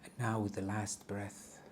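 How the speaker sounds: calm, soft meditation guide voice; guided meditation. Acted. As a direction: ease them into a body scan. Leads a grounding meditation.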